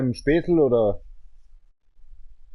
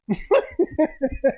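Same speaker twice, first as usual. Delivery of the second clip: high laugh; 13 semitones higher than usual. Real, not acted. Laughs on phone.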